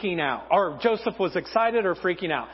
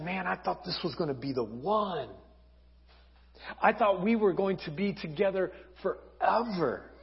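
upset and hurt; 2 semitones lower, 5 dB quieter than usual. Acted. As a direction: quietly mourn a lost love. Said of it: "what he's most upset about".

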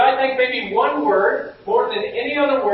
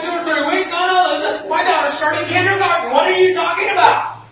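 higher, louder alarmed protest, ending in a shout; retold panic; 8 semitones higher, 4 dB louder than usual. Pastor protesting the repair wait, rising alarm. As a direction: disbelief building to a near-shout.

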